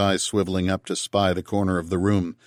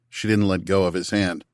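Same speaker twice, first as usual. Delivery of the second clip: usual speaking voice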